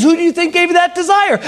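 high, pressing pastor's question; emphatic questioning. Retold or imitated. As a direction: press the point, pitch up high.

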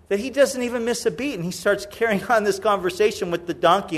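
ordinary talk, nothing marked

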